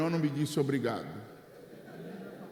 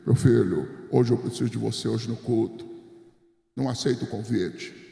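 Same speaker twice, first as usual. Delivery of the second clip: much lower, imitating the old pastor; a low, grave pastor's voice; pastor Tonhão's way; 6 semitones lower than usual. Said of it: "disse daquele jeito dele". (imitando o pastor Tonhão com voz bem grave)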